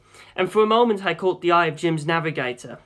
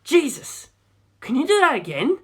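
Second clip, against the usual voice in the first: high, thrilled amazement; amazed; 9 semitones higher than usual. A narrator's reading. Navigator amazed by the pilot's lucky shot, thrilled. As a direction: gasp it out in disbelief. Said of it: "Jim asked".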